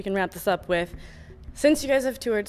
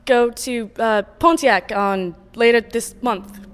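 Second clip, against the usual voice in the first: slow, louder mock English presenter voice; English accent; 4 semitones higher, 6 dB louder, slower than usual. American interviewer asked to do an English accent, presenting the band. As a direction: announce it like a British radio host. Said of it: "Can you do an English accent".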